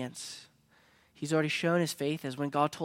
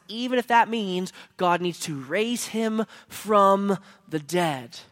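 louder, emphatic sermon push; preaching; 2 semitones higher, 5 dB louder than usual. Acted. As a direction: drive the promise home. Preaching the promise with force.

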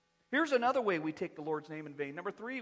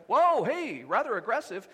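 startled, louder "whoa, hey"; a mock-shocked recoil at rudeness; taken aback; 3 semitones higher, 8 dB louder than usual. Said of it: "You're like".

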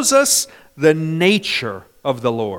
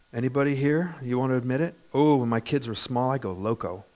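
ordinary talk, nothing marked

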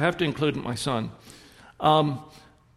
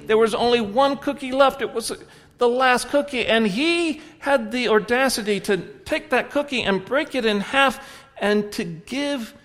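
building excitement; 8 semitones higher, 4 dB louder than usual. Acted. Story climax, animated telling.

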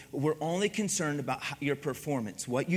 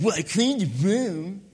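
slow, drawn-out mock-sullen retort; awful-attitude child imitation; 4 dB louder than usual. Kid after cleaning the room, drawn-out imitated sulky retort. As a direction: drag out the words like a resentful kid. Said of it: "their attitude was awful".

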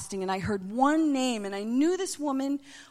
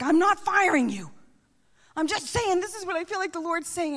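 high, raised-voice imitation of an alarmed pastor; flustered protest; 9 semitones higher, 4 dB louder than usual. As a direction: protest loudly, pitch jumping up. Pastor protests at her crying.

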